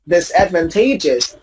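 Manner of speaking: disadvantageous stressed on TA, dis-ad-van-TAY-jus; careful dis-ad-van-TAY-jus; same shifted stress. Shown as a demonstration. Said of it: "Same for dis".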